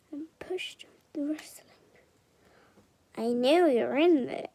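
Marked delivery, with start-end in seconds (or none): 3.2-4.5 low, loud rumble from the bushes; deep rumbling voice. A narrator's reading. Dragon to hidden Mister Tickle, loud low rumble. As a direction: rumble it out like a big beast. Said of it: "rumbled a very deep voice".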